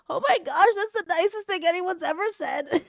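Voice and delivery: high-pitched retold gushing; squeaky-high, overcome by kindness; touched gushing. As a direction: retell being moved, voice way up high.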